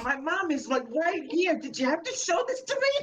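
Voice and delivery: high, whining mock of a squeamish kid; whiny mock voice. Mocking his friend as a prissy kid, high whine. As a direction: squeak it out like a prissy kid embarrassed in front of mom.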